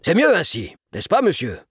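mildly self-satisfied dad; pleased father. A narrator's reading. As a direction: turn to the author seeking approval. Proud father seeks the writer's approval.

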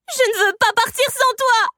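high, loud desperate shout; child's cry. A narrator's reading. Girl clinging to the sorceress, crying out in protest. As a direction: cry out as a little girl, pitched far up. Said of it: "cria Marianne".

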